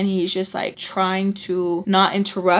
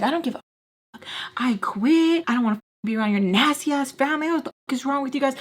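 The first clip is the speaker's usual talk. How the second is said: retold fed-up rant, higher-pitched; pissed; 5 semitones higher, faster than usual. Going off on him about his family, pissed. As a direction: rattle off the angry outburst, pitched up. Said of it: "I'm pissed".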